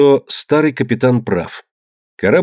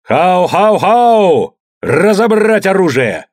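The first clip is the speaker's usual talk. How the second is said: higher, loud, slow war shout; rally the crew to arms; battle cry; 8 semitones higher, 4 dB louder, much slower than usual. A narrator's reading. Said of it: "скользнул вниз с криком".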